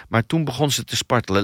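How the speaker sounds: plain reading voice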